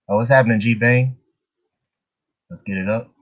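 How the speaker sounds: normal talking voice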